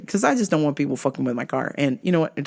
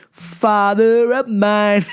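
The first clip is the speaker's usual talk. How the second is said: very slow, louder, stretched; drawn-out exasperation; 3 semitones higher, 4 dB louder, much slower than usual. Shown as a demonstration. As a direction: drag out each word in disbelief. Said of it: "drawn out emphatic repeat".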